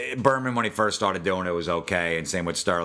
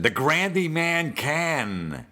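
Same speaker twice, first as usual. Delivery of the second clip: high, slow, drawn-out home run call; milk it like a corny radio announcer; mock Sterling call, higher and slow; announcer catchphrase; 7 semitones higher, much slower than usual. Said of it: "What about the, uh, Curtis Granderson?".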